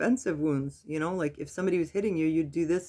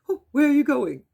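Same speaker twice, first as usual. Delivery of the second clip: higher, louder mock-confused old mother; mom's puzzled question; 9 semitones higher, 7 dB louder than usual. Daughter imitates her confused mother asking where she's going, high and loud. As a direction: ask it like you forgot what you just said, high.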